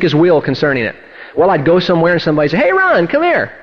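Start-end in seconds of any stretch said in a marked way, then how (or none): 2.6-3.5 high-pitched beckoning call; calling him over. Retold or imitated. Somebody beckons the pastor aside, calling him over. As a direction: call out high, as if hailing a friend.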